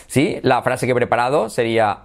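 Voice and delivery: ordinary talk, nothing marked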